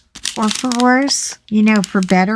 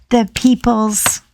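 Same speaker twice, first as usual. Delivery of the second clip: ordinary talk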